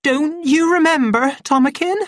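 very high, anxious plea; distressed, high-pitched pleading; trembling voice. A narrator's reading. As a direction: beg to be remembered, high and loud. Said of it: "repeated in a voice that trembled".

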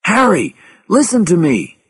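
high-pitched, insistent call; urgent plea. A narrator's reading. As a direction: make him listen. Pleading warning over radio.

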